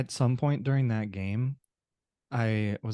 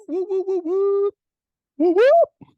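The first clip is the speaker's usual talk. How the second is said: high, loud hype-man woos; rising whoops to kick off the show; 11 dB louder, slower than usual. Real, not acted. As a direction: pump up the crowd with whooping. Podcast host hyping the intro.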